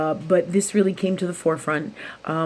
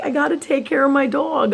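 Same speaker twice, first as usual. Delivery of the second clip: high, louder, pressed complaint; exasperated plea; 9 semitones higher, 5 dB louder than usual. Retold or imitated. Telling God she must care for her dog, high and exasperated. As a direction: push back at the universe, pitched up and pleading.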